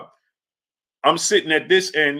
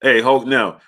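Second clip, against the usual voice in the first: ordinary talk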